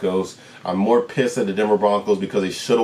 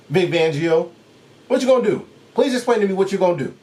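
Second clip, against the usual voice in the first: high, pressing questions fired at the coach; exasperated demand; 9 semitones higher, faster than usual. Real, not acted. Vlogger calling out Vic Fangio, high exasperated demand. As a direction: demand an answer you know won't come.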